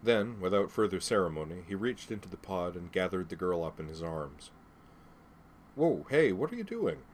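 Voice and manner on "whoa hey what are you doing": high-pitched, alarmed objection; startled protest. Acted. Nick objecting as the girl is picked up, startled protest. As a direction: blurt it out, voice jumping up high.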